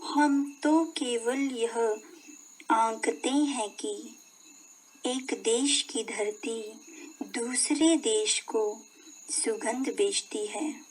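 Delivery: soft, measured verse reading with pauses; spoken poem recitation. Shown as a demonstration. Teacher reading the poem's next stanza to children, soft spoken recitation. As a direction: recite it softly in a speaking voice, phrase by phrase. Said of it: "का वाचन हम करते हैं".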